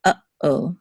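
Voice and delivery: uh-oh split into uh, then oh; pause between syllables. Shown as a demonstration. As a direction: stop briefly inside uh-oh. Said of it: "there's a pause between uh".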